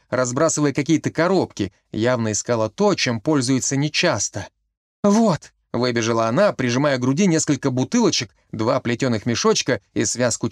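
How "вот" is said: high, loud cry of triumph; a sudden found-it shout; exclaiming. A narrator's reading. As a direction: burst out with the find. Marijka bursts out with find.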